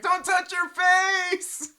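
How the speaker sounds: high, drawn-out hammed-up yell; mock warning shout. Mimicking someone scolding about face touching, high slow shout. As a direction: shriek the warning like a panicked parent.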